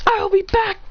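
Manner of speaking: high-pitched mock defiance; defiant parting shot. A narrator's reading. Student leaving after slapping the teacher, defiant parting shot. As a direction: announce it high as you leave.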